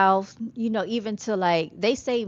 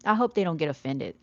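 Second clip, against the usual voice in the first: usual speaking voice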